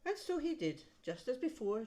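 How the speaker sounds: ordinary delivery, nothing marked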